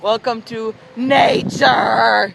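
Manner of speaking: slow grand announcer voice; mock announcer. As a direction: announce it slowly like a nature show.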